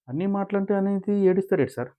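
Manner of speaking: high-pitched imitated grievance; mock plaintive protest; acted complaint. Acted complaint in retold quarrel.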